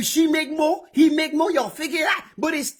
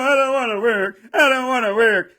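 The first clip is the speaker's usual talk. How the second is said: mock whining; 2 semitones lower than usual. Comic mocking complainers: whiny lazy worker moaning. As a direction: whine like a lazy worker who won't work. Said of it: "complaining about your job".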